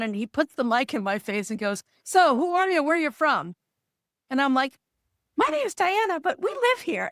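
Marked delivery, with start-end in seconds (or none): 2.0-3.5 lightly voiced TV interviewer, higher pitched; TV host questioning. TV host questioning her on air. 5.3-7.1 higher-pitched, eager reply to an interviewer; excited on-air introduction. Retold or imitated. Nervous answer into the TV microphone.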